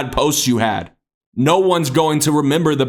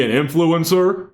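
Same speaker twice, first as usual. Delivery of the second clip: mocking friend; 2 semitones higher than usual. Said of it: "they're gonna be like".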